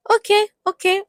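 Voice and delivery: high, loud exaggerated okay, okay; sarcastic okay. Real, not acted. Vlogger mockingly letting Iyabo's snub slide, high and loud. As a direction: sarcastically let it go.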